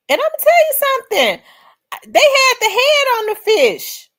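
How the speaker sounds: high excited outburst. Real, not acted. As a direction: burst out with amazement. Excited telling about fish teeth.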